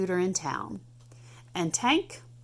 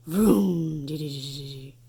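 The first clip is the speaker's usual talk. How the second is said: engine vroom; 3 semitones lower than usual. Acted. Engine noise read aloud.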